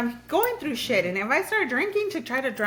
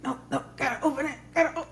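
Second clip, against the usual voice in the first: hushed, quick whisper to herself; whispered inner voice; 6 semitones higher, faster than usual. Retold or imitated. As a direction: whisper it fast, arguing with herself. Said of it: "and then I'm like".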